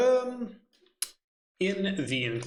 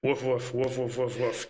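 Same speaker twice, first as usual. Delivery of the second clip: barking like a dog. Pretend. Player barks like a wolf.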